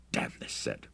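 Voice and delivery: very soft, voiceless muttering; low grumble, barely voiced; muttered. A narrator's reading. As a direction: curse under your breath. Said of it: "muttered Bruce".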